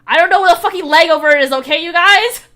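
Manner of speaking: loud, high, fast exasperated yell; shouting. Acted. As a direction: blast it out in disbelief. Exasperated outburst reading the message.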